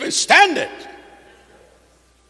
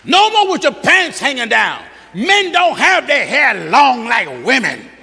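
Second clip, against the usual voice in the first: raised, rhythmic pulpit preaching; fiery pulpit cadence; 2 semitones higher than usual. Acted. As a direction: preach it out to the congregation, full force. Preaching hard on appearance.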